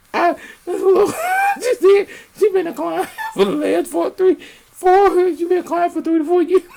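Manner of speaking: high, fast, laughing gush. Real, not acted. Laughing hard retelling the client line. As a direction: rush it out through laughter.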